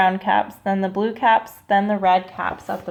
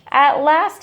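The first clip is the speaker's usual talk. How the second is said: ordinary delivery, nothing marked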